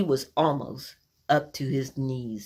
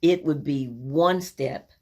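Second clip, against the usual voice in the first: normal talking voice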